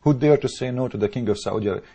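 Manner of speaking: ordinary delivery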